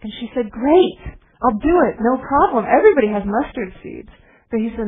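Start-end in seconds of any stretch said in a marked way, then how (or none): 0.5-4.1 eager reply. Retold or imitated. Eager woman in the parable.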